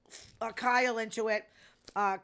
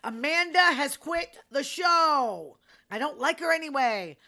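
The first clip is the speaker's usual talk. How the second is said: excited gossip; 5 semitones higher, 8 dB louder than usual. Real, not acted. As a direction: drop the big news like a bombshell. Big gossip announcement.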